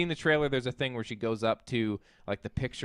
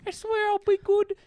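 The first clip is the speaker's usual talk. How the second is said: much higher, louder Cockney doll voice; a playful Cockney doll calling, pitched way up; creepy Cockney doll; 5 dB louder than usual. Retold or imitated. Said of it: "Cockney accent".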